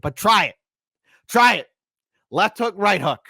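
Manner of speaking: high, loud goading; mock fighting dare. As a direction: dare them like a brawler. Dares authorities to try it.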